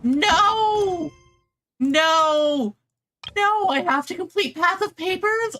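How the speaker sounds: loud, high cries of protest; frustrated shout. Real, not acted. Gamer stuck on an unwanted quest, shouting high in protest. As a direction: yell no in real dismay. Quest blocks the story progress.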